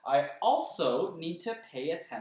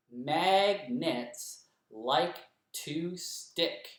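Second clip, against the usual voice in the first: very slow, every syllable separated; clapped syllables; much slower than usual. Shown as a demonstration. Teacher claps each syllable of the line with the class. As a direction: beat out "Magnets like to stick" one syllable at a time. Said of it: "I'll say it and use my fingers to count".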